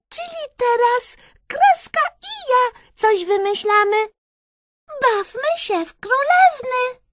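very high, squeaky mouse voice; Myszka squeaking. A narrator's reading. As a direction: squeal the argument like a little mouse. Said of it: "i zapiszczała".

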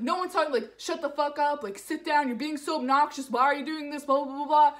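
loud, high, running-on tirade; imitated angry rant. Man snapping back at passenger, imitated angry rant.

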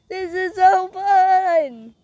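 slow, loud, high thrilled shout; excited. Real, not acted. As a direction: savor every word with joy. On the spinning ride.

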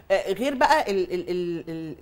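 ordinary talk

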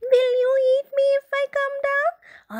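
high, fast squirrel worry; squeaky quick squirrel question; nervous squirrel. Acted. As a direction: chatter it out like a wary squirrel. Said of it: "asked the tree squirrel".

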